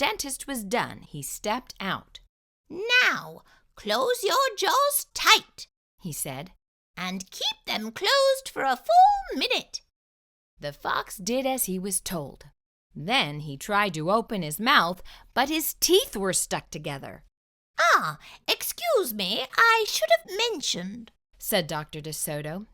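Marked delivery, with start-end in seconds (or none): 2.7-9.8 high, loud and measured orders; high mouse voice. Acted. Dentist instructing the fox, high firm voice. 17.8-21.1 mock apology. Dentist fooling the fox, high mock apology.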